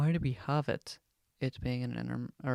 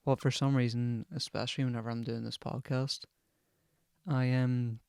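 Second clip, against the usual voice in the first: normal talking voice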